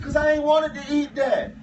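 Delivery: put-on bawling complaint; imitating crying child. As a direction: play a boy hollering through tears. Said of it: "he fell out hollering and crying".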